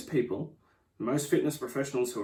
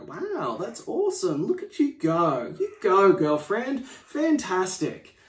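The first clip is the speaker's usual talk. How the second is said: higher, much louder hammed-up cheering; mock gushing praise; 6 semitones higher, 5 dB louder, slower than usual. People cheering a friend's weight loss, hammed-up gushing. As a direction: gush over them like a hype friend. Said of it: "They instantly go".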